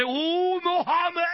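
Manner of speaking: high, loud mock "ooh" from the back row; jeering classmates. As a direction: hoot it like a rowdy class. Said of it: "all the guys in the back say".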